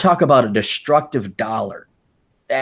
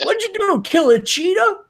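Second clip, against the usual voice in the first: much higher, squealing film line; movie quote. Retold or imitated. Recalling the Road Trip scene, high mock squeal. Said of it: "It's like".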